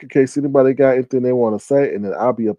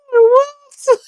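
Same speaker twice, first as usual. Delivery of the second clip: very high laughing what; laughing disbelief; 4 dB louder than usual. Pretend. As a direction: squeal it through a laugh.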